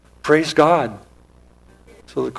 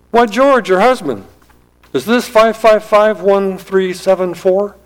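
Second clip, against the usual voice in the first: startled; 7 semitones higher than usual. A narrator's reading. Caller startled by the question.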